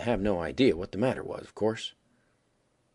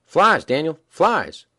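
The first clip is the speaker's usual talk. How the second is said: loud sing-out call; 6 semitones higher, 10 dB louder, much slower than usual. A narrator's reading. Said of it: "and sing out".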